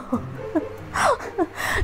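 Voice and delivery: real high breathless laugh. Real, not acted. Telling viewers about arguing with her husband, high breathless laugh. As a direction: laugh it out high and airy, almost crying.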